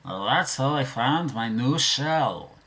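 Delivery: a dopey cartoon creature telling its tale; cartoon character impression. Shown as a demonstration. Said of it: "easy for me to do impressions".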